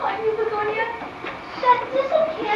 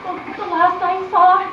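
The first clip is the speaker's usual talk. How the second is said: ordinary talk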